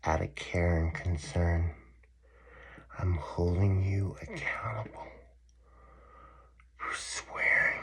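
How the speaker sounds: slow hushed sanctimonious quote, whispered at the end; mock pious inmate. Imitating the inmate who confronts him, slow and hushed.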